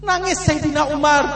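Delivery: normal talking voice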